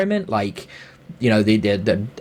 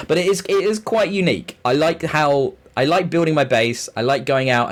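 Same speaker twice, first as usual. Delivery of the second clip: ordinary talk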